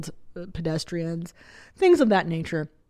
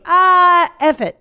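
long loud high "Ah", then drop; exasperated little-kid outburst, imitated; toddler cursing; 11 semitones higher, 9 dB louder, slower than usual. Son curses out of nowhere at the cheeses, mock exasperation.

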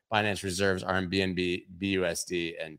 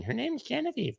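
very high squeaky imitation of hecklers; mock screaming; 5 dB quieter than usual. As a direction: imitate nagging viewers in a thin falsetto. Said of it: "you guys kept screaming".

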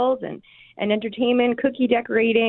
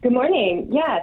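normal talking voice

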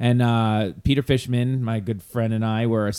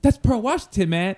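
excited fan; 9 semitones higher than usual. Retold or imitated. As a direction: gush like a starstruck fan. Excited retold fan outburst.

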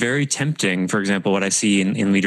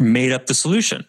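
ordinary talk, nothing marked

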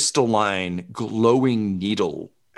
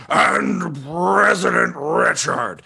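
high, slow, rough drawn-out voice; President Richard; 8 semitones higher, slower than usual. Acted. Former president chiming in over the loudspeaker, rough slow voice. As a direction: roll it out slowly in a rough, raised voice. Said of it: "Over the loudspeaker, you hear".

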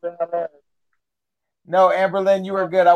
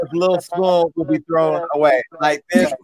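usual speaking voice

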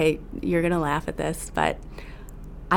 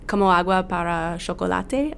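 put-on Spanish accent. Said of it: "my terrible Spanish accent".

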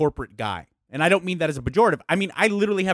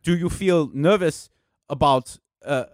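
a light imitation of a German accent; a hint of a German accent; 3 semitones lower than usual. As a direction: lightly mimic the German boss asking a question. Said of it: "he had a very heavy accent".

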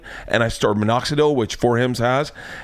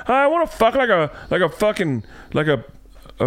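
higher, fumbling request to a doctor; embarrassed patient; 9 semitones higher than usual. Retold or imitated. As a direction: stammer it out awkwardly. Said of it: "tell them".

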